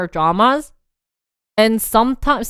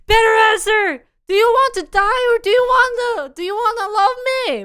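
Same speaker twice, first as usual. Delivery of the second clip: high, loud hammed-up demand; drama hero ultimatum; 13 semitones higher, 5 dB louder than usual. Retelling the car scene, hero's hammed-up demand. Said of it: "he's like".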